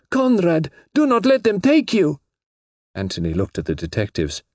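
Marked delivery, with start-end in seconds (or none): 0.1-2.2 very high desperate plea; wailed. A narrator's reading. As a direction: wail for him to resist. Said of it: "wailed the girl".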